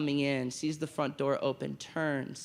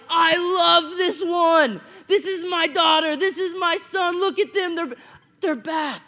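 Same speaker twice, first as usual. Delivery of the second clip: much higher and louder, slow exultant shouting; joyful yelling; 13 semitones higher, 10 dB louder than usual. Retold or imitated. Father yelling in public over his returned child, joyful. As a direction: shout it out so the whole street hears. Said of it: "and yelling".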